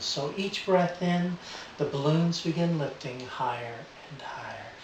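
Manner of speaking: soft hypnotic suggestion; gentle trance voice. Real, not acted. Live induction continues.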